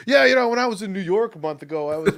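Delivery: high, lightly affected brag; a light mock of name-dropping New York; hint of a snooty traveller. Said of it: "every interesting story I have starts like".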